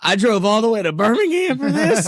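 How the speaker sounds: high, squeaky mock disbelief, laughing; incredulous squeal. Retelling his own reaction to the pastor's sermon, high incredulous squeal.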